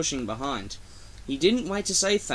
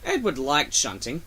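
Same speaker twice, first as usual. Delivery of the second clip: ordinary delivery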